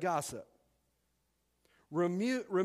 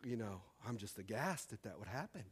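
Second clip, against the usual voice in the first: lower, much softer mock shock; hammed-up hushed dismay; mock aghast gossip; 6 semitones lower, 6 dB quieter than usual. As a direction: hush it like scandalized church lady. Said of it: "and then the person".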